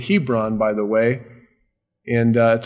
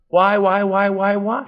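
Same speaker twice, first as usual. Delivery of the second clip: higher nagging repeated why; whining child; 7 semitones higher than usual. Retold or imitated. Believer pestering God like a child, higher whine. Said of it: "we do the same thing with God".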